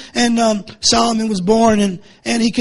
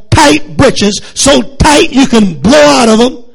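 loud preaching with rising pitch; fiery preaching; 2 semitones higher, 4 dB louder than usual. Acted. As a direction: preach it hard to the congregation. Preaching at full force.

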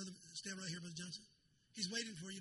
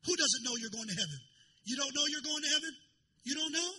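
urgent call; 8 semitones higher, 6 dB louder than usual. Real, not acted.

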